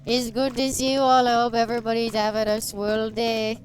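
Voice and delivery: hammed-up Canadian accent; full Canadian accent. Streamer introducing themselves to everybody, playful thick Canadian accent. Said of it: "There's the Canadian accent full stream".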